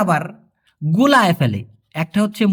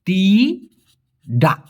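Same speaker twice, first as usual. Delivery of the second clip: "তিডা" stretched very slowly with a falling tune; drawn-out teaching word; much slower than usual. Shown as a demonstration. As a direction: hold each sound of "তিডা" for the learner. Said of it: "direct নাকে বলা হয়". ("তিডা" খুব ধীরে টেনে বলা)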